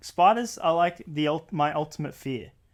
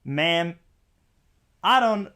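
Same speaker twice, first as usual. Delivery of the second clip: put-on loud, high accent gone American; botched accent turning American; slipping American accent; 4 semitones higher, 5 dB louder, slower than usual. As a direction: attempt Aussie, slip into American in frustration. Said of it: "I keep going back to the American accent".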